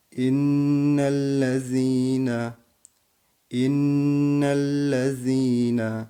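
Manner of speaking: slow chanted tajweed recitation, held double n; Quran recitation. Shown as a demonstration. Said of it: "Bakın nun harfinde şedde var".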